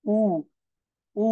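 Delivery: উ as pure "oo", no glide; German-style উ, a held "oo"; German letter name উ. Shown as a demonstration. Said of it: "তারা বলতেছে উ".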